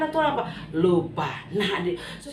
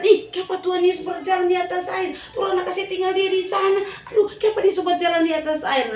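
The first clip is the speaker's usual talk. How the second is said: raised, amazed outcry; astonished exclaiming; 8 semitones higher than usual. Acted. Disciples amazed he walks on water. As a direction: exclaim in disbelief. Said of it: "Orang heran".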